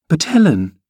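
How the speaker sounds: put-on high girlish cry of protest; astonished. A narrator's reading. Girl crying to Ellen at the insult, astonished. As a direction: appeal to the nurse in astonishment. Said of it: "staring fixed in astonishment".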